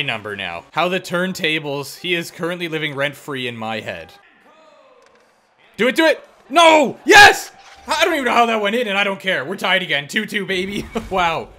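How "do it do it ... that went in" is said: loud high begging into a screamed yes; real explosive joy; wild goal celebration. Real, not acted. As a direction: yell as the puck goes in. Goal scramble.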